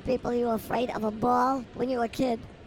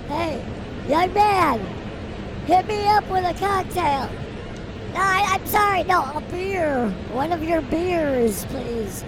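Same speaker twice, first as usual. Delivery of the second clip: calling out to vendor; 5 semitones higher, 4 dB louder than usual. Acted. Acting out ordering a beer.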